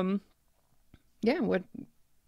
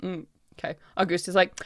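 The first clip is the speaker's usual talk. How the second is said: ordinary talk, nothing marked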